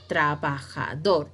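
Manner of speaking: "trabajador" in measured, separate syllables; split into syllables. Shown as a demonstration. Teacher asking for the stressed syllable, "trabajador" syllable by syllable. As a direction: space out tra, ba, ja, dor in "trabajador". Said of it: "La que es la sílaba tónica".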